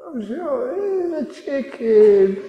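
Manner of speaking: high, slow, tearful whimper; wife about to cry, still insisting on the chicken; teary wife. Acted. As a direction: plead on the verge of tears. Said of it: "she started to get teary".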